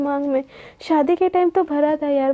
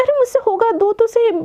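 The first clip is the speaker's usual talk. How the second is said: higher-pitched, eager objection; insistent protest; 7 semitones higher than usual. Acted. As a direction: protest like you refuse to be doubted. Insists she can do it. (ज़िद भरे ऊँचे सुर में)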